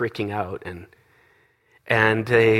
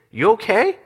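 raised, high questioning call; calling back; 10 semitones higher, 4 dB louder than usual. Retold or imitated. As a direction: call out a check-in to someone behind you. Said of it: "just kind of yelled back".

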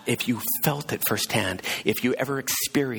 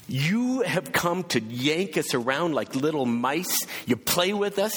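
defensive accusation; 7 semitones higher than usual. Retold or imitated. Husband confronting the tax man, defensive and bitter. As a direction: throw the charge back at him, defensive. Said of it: "his defensive mechanisms kicked in".

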